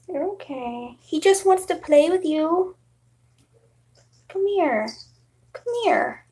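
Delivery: gentle sweet talk to a nervous cat; coaxing pet talk. Real, not acted. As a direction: coax the cat to come out. Coaxing cat, soft high.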